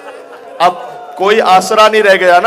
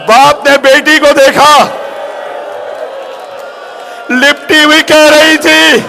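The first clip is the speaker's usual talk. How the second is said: impassioned majlis preaching; 8 semitones higher than usual. Acted. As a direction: wail the child's plea. Rising mourning recitation.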